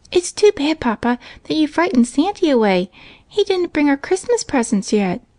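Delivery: put-on high, eager child's chatter; a small girl rushing to tell her father everything; little girl voice. A narrator's reading. Little girl telling her father about Santa, excited. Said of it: "said Minnie".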